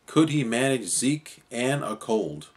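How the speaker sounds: plain reading voice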